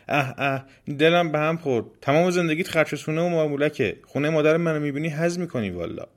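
high-pitched snooty revulsion; haughty, disgusted girl's voice; revolted disgust. Acted. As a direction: turn up your nose at the filth. Rude girl revolted by demon's house.

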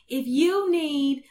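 high, slow New York recorded message; attempted New York accent. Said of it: "can't even do a New York accent".

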